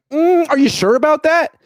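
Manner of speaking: high-pitched, incredulous challenge; openly skeptical. Real, not acted. Mock doubt at coach's quoted claim.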